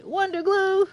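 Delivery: high, loud delighted call; pleased to find it; excited. Real, not acted. Streamer spotting Wonder Glue, high and excited. Finds loot again.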